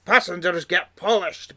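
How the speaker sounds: lightly voiced sulky engine complaining; mock-grumbling engine quote; grumpy Duncan. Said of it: "there is a quote".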